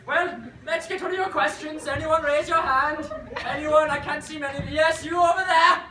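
ordinary delivery

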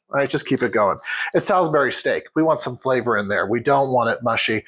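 normal talking voice

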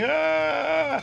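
one long, high "ah"; mock yell. Man down on the ground yelling, high mock yell. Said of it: "ground yelling".

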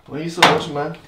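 why are you still here, pal; mock incredulous. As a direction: needle the viewer in fun. Teasing viewers who keep watching.

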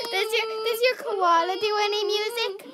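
loud and fast, barely holding it together; laughing. Real, not acted. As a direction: ask it while cracking up. Host cracking up mid-question.

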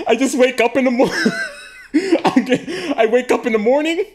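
high, quick words broken by giggles; can't get through it for laughing; laughing fits while telling the story; giggling. Real, not acted.

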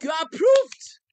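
loud, high triumphant shout; shouted declaration. Acted. Preacher shouting the approval over a member, triumphant shout.

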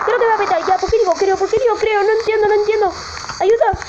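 high, rattled babble; anxious, high-pitched rush of repeats; nervous panic. Real, not acted. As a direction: fret in a high voice as collapse looms. Structure about to collapse.

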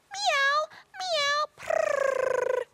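high meow then a purr; playful cat. Pretend. Presenter trying on cat ears, high meows and purr. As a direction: play the cat in new ears.

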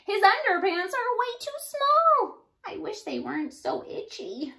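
high, loud whiny monster complaint; squeaky high grumble; complaining monster. Acted. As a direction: whine it like an itchy monster.

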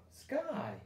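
"Sky" pops up high and bright; blended answer. Shown as a demonstration. Teacher solving the word for kids, bright high answer. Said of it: "I'm gonna try that I sound".

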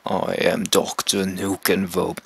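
slow, low villain introduction; attempted deep villain voice. Introducing the villain by name, deep voice. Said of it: "how do you do a deep voice?".

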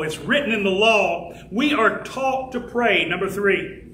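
ordinary delivery

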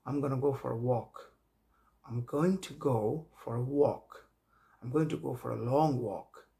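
walk said slowly with a rounded "wɔk"; slow, careful English sentences; slow model sentences. Shown as a demonstration. Teacher slowly modelling walk in sentences. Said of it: "model sentences for walk".

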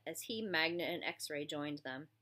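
ordinary delivery

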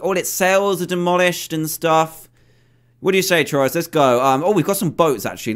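ordinary delivery

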